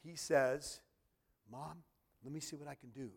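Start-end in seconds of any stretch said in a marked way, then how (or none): 1.5-3.1 soft, gentle voice of a caring son; tender. A narrator's reading. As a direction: say it softly and kindly, as if to a worried mother. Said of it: "so tenderhearted".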